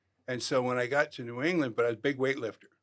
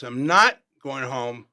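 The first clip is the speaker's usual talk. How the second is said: louder and slow, defiant; retold scream; 9 dB louder, slower than usual. Refusing to leave, to the coach's messenger, a loud slow yell. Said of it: "And I screamed".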